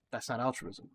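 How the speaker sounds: ordinary talk, nothing marked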